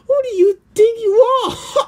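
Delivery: high, loud, crying-out demand; indignant outburst. Acted. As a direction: yell it up high like you've been insulted. Character lashes out mid skit.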